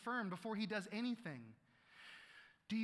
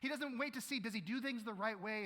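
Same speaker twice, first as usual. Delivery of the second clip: usual speaking voice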